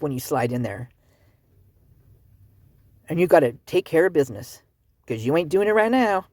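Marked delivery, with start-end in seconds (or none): none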